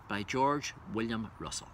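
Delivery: normal talking voice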